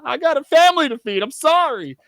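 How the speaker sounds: high mock apology. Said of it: "It's like".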